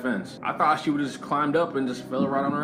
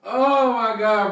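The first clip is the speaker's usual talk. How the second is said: laughing; 9 semitones higher, 3 dB louder, much slower than usual. Real, not acted. Reacting to the crude clip.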